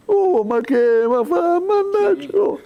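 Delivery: drawn-out, high-pitched Italian exclamations; exclamação italiana expressiva. Shown as a demonstration. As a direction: demonstrate an exaggerated Italian outburst, stretching each sound. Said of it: "a expressão do que mil palavras". (exclamação italiana exagerada e arrastada)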